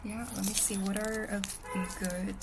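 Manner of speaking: ordinary talk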